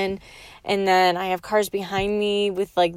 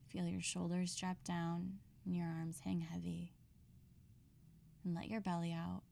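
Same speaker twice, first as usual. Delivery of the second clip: soft, hushed meditation voice, much quieter than her chat; guided meditation; 19 dB quieter than usual. Acted. Host guiding podcast listeners through a relaxing breath, soft and hushed.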